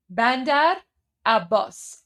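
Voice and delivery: "Bandar" rising then "Abbas" falling, like a native Persian speaker; correct local pronunciation. Shown as a demonstration. Teaching viewers the Iranian port name, said the proper Persian way. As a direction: say "Bandar" up and "Abbas" down with the stress on "bas". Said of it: "There you go".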